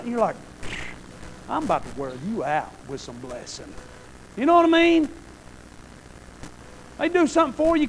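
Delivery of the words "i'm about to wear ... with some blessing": low, soft teasing threat; low soft playful. Retold or imitated. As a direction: murmur it like a fond dad. Parent's joking reply.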